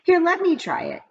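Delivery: put-on high eager boy's voice; lightly voiced eager boy. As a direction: jump in like a keen kid. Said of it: "said Todd".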